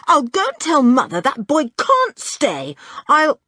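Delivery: high, loud angry threat; losing temper. A narrator's reading. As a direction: threaten to go and complain, heated. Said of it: "losing her temper again".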